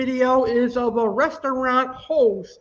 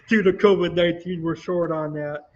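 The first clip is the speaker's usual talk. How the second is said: laughing; 5 semitones lower than usual. Real, not acted.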